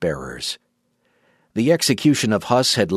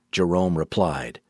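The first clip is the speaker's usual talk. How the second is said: usual speaking voice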